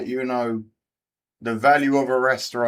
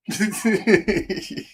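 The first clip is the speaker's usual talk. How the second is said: higher-pitched teasing giggle; 6 semitones higher than usual. Real, not acted. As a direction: giggle after a friendly jab. Laughs after teasing Packers fans.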